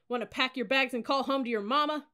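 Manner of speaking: loud, high goading bark; scornful drill-sergeant heckling; jeering taunt. A narrator's reading. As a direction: taunt a rookie at full voice. Cadet officer taunting her.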